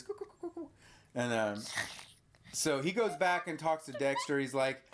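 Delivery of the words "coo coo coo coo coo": tiny high, soft cooing; cute chirpy noises. Retold or imitated. Imitating the monster's little noises, high soft chirps. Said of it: "the cute little chirpy noises".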